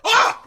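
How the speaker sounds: sudden high, loud yelp; re-enacted yelp of agony; imitated pain scream. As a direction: cry out as if jolted by pain. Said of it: "I was in so much pain".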